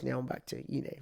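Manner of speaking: put-on relaxed UK accent after work; Damson Idris. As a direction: slip back into a British accent. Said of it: "then he talks like this".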